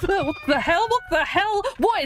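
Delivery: high, loud, startled laughing yelp; startled shriek. Real, not acted. Streamer ambushed by a cockatrice in game, yelps in real fright. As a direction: jump out of your skin and yelp it. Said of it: "That actually scared the crap out of me".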